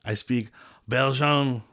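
slow mock Belgian accent, I speak Belgian; hammed-up Belgian accent. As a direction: play a slow Van Damme-style Belgian. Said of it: "You need to do it like this all night now".

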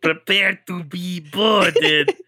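slow, drawn-out villain line; slow sneering boarding threat; menacing alien threat. Acted. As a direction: draw it out like a space pirate. Alien captor threat, retried.

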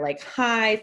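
ordinary talk

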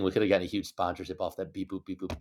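soft, fading mock lament; quiet mock regret. Real, not acted. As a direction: let it fade in mock regret.

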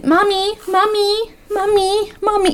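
high, nagging repeated whine; spoiled brat voice. Retold or imitated. A spoiled brat nagging mom, high whine. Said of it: "you sound like a spoiled fucking brat".

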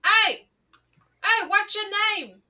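high mock call-out; high-pitched mimicked hailing; TikTok sound imitation. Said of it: "TikTok sound of".

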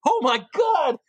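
high, starstruck cry; acted fanboy outburst. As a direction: re-enact losing it before your idol. Fanboy meeting his idol.

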